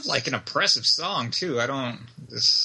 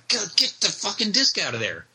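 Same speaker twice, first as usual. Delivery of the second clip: frustrated outburst; 7 semitones higher than usual. Retold or imitated. Frustrated with double disc case.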